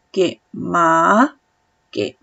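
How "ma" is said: MA rising like climbing stairs; second tone. Shown as a demonstration. Teacher contrasting tones for student, MA climbing. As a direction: let MA glide upward. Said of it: "como si subieras una escalera".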